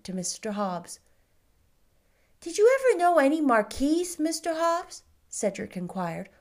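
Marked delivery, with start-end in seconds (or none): 2.4-5.0 put-on higher, eager child's voice; a curious small boy, bright and keen; little boy asking. A narrator's reading. Boy asks the shopkeeper about marquises, high and eager.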